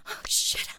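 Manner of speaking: hushed, voiceless gasp of words; breathless whisper. Acted. Overwhelmed, breath taken away.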